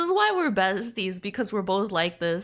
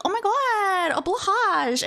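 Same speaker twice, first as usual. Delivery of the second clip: high, loud excited gasp; a fan spotting a Blahaj; excited squeal; 11 semitones higher, 4 dB louder than usual. Retold or imitated. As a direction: gush at the plushie. Retelling her excited reaction.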